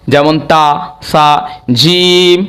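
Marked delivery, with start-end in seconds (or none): 1.8-2.4 "জিম" with a long held vowel; teacher stretching "জিম" on one tone; elongated sound. Shown as a demonstration. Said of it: "টান দিয়ে বলেছি".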